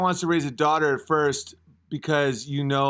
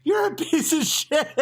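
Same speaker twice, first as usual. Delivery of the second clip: high-pitched mock outrage; mad wife imitation; 13 semitones higher than usual. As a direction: imitate a wife scolding, amused underneath. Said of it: "she was like".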